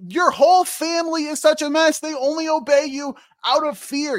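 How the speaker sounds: high, forceful hero outburst; hammed-up indignant retort; mock accusation. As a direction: hurl it as a playful accusation. Acts Percy accusing Zeus.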